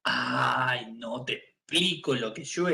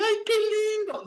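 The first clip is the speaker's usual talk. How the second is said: much higher sweet gushing coo; cooing clerk; 3 dB louder than usual. Retold or imitated. Pharmacist cooing over the newborn, high sweet gush. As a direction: gush like a charmed shop clerk. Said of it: "Entonces va a decir".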